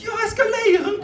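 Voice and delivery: very high, thrilled squeal; hammed-up high approval; delighted CEO. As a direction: cry out in mock delight at the Luxembourgish word. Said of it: "And he goes".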